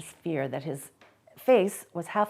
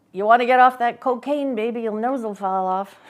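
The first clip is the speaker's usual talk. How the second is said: teasing; 6 semitones higher, 10 dB louder than usual. A narrator's reading. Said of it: "Same old Johnny".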